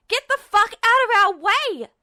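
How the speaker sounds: high, loud enraged screaming; Ed screaming at the guard mid-fight, enraged; furious scream. Acted. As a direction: scream it while raining blows. Ed keeps screaming while hitting.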